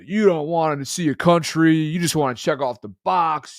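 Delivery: mock his lecturing tone; lecturing friend. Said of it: "he wouldn't have any of it".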